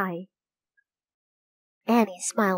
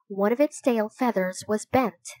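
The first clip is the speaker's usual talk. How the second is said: usual speaking voice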